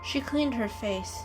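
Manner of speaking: ordinary delivery, nothing marked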